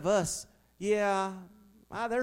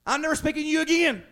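higher and fast, in a petulant huff; mock huffy parent; 7 semitones higher, 4 dB louder, faster than usual. Fed-up parent huffing at their child. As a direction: snap it out like an exasperated parent.

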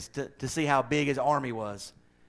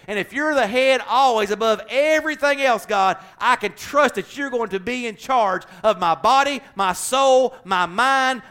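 preached prayer, climbing higher and louder; calling God head of every part of life, louder; fervent prayer; 7 semitones higher, 6 dB louder than usual. Acted. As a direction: pray it out with rising force. Fervent prayer at full force.

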